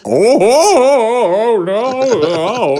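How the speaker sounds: long, high comic ohs; mock old man. Old man reacting to her lifted skirt, drawn-out mock dismay. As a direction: draw out exaggerated high-voiced dismay.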